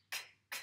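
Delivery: "k" as a bare voiceless click; isolated k sound. Shown as a demonstration. Teacher isolating the sound for a pupil, bare "k". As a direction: say "k" alone, no vowel after it. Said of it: "que sur le son k".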